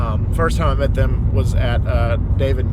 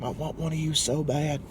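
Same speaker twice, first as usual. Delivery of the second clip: tender coo; 10 dB quieter than usual. Retold or imitated. Holding the baby, cooing.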